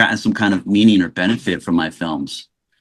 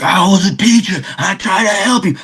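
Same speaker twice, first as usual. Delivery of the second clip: Samuel holding tears; 11 semitones higher than usual. Retold or imitated. Retelling Samuel's emotional line to the host, higher and tearful. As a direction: imitate his mentor choking up on the line.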